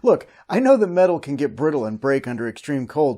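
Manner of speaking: ordinary talk, nothing marked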